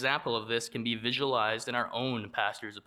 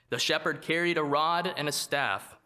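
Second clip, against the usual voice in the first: ordinary talk, nothing marked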